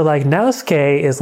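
ordinary talk, nothing marked

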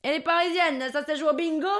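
high-pitched Marseillais mockery; teasing southern local. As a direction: needle the Parisian in a southern voice. (Marseillais moqueur, voix haut perchée)

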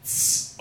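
voiceless hiss of a can being opened; can opening hiss. Retold or imitated. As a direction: hiss like cracking a beer can. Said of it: "at the back he hears the".

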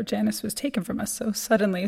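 ordinary talk

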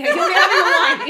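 high laughing tease. Real, not acted. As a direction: laugh through the words. Teasing her friend, laughing.

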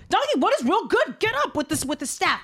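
hammed-up angry rider; annoyed Balaam. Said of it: "And Balaam goes".

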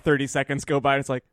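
ordinary talk, nothing marked

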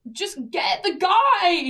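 high, loud and drawn out; mock-exasperated cry at a struggling stranger; exasperated retold shout. Retelling advice to woman stuck at gates, exasperated shout.

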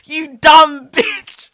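loud, slow insult breaking into a laugh. Retold or imitated. Patient venting about the new doctor, loud and laughing. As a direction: spit it out half laughing. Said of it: "I was like".

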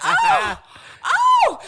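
high squealing laughing shriek; mock shriek. Host voicing Villanelle's shocked delight, shrieking.

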